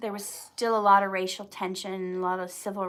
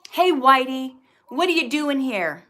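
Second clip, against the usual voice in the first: louder, higher, taunting shout-out; imitated hostile challenge, raised voice; mock menacing call; 4 semitones higher, 9 dB louder than usual. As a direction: call out like a street tough sizing him up. Voicing attackers in retold story.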